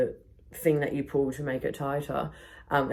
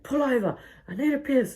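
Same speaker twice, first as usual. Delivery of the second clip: put-on high whiny drunk demand; high pleading drunk voice; mock drunk whine; 10 semitones higher than usual. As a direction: whine like a drunk needing the loo.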